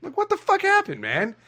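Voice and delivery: high squeaky what the fuck; shocked disbelief, voice jumping up; incredulous. Acted. Disbelief at fighter.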